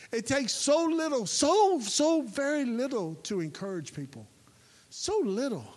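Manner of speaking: preaching burst; emphatic shout. Real, not acted. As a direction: hammer home how little it takes.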